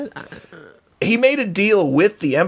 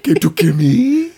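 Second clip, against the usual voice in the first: Saw Gerrera impression; 2 semitones higher, 4 dB louder than usual. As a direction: rasp it out like a battered rebel. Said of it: "That voice will never".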